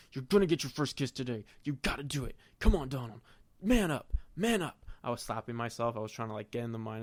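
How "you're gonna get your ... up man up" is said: building to loud, higher cries of man up; psych-up pep talk. Retold or imitated. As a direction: pump yourself up, louder each line. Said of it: "my psych up speech was like".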